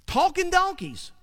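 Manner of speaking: Talking donkeys, pitched high in mock disbelief; high amused incredulity on Talking donkeys; incredulous. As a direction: say Talking donkeys as if it's absurd. Repeats phrase in disbelief.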